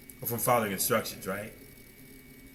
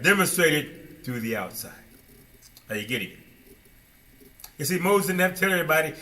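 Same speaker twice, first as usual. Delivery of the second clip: ordinary delivery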